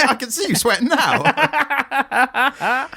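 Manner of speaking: high, slow, laughing taunt; laughing mock taunt. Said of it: "Yeah, it's like".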